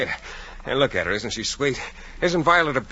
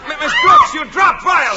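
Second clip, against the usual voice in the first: panicked shout; 11 dB louder than usual. Acted. Mouse dropped, panicked shouting.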